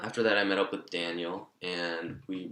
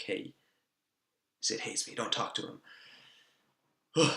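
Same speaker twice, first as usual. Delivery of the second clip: whispering. Retold or imitated. Urgent warning to friend about Sid.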